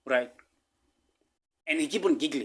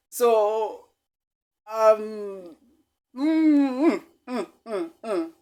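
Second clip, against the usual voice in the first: nervous laugh; 8 semitones higher, 5 dB louder, much slower than usual. Real, not acted. Overcome recalling the fear.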